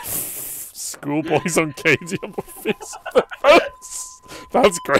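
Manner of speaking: loud, high, laughing delivery; helpless laughter pushing the voice up; real laughing outburst. Real, not acted. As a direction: crack up while repeating the joke. Cracking up at a joke.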